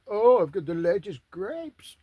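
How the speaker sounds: hammed-up pompous Roman bragging; mock posh Roman. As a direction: brag about your grapes like a pompous Roman. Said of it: "just see 'em all like".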